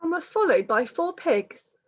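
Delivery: lilting rhyming storybook read-aloud; reading to children. Acted. Reader telling children the pandemic story, lilting storytelling. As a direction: carry the rhyme along for little listeners.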